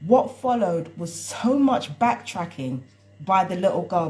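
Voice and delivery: usual speaking voice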